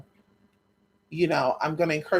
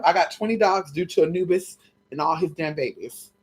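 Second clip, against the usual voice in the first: ordinary talk, nothing marked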